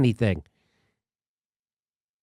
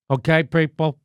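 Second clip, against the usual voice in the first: Bill Maher impression. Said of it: "That's me doing Bill Maher".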